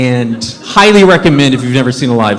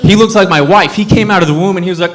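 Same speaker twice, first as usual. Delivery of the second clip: usual speaking voice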